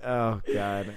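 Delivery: ordinary talk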